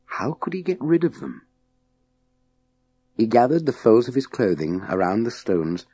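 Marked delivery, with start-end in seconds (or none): none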